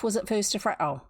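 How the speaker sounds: plain reading voice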